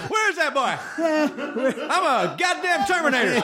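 very high Southern Terminator; hammed-up high Southern twang; Southern drawl. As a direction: play the robot as a squeaky Southern good old boy. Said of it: "a little bit of the southern".

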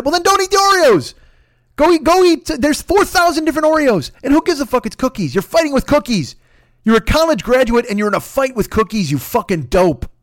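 high, loud comic tirade; exasperated yelling rant. Real, not acted. Host berating people fighting with cookies, exasperated yelling. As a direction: yell back, pitch way up. Yells back at Oreo complainers.

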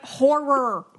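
"Horror" in two clear syllables, hor-ror; "Horror", said slowly in two syllables; correct pronunciation. Shown as a demonstration. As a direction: set it right with a full "Horror". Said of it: "I'm like".

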